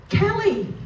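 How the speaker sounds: put-on calling out high and bright, like spotting an old friend; fake friendly greeting. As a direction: call the name up high with fake delight. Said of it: "I went like this".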